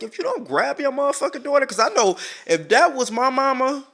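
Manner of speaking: high-pitched, indignant scolding; heated scolding. Pretend. Thinking what she should tell that mama, heated scolding.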